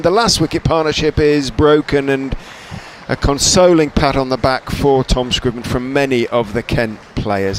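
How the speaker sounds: fading from loud commentary call; lively cricket commentator, winding down. Real, not acted. Live play-by-play after wicket.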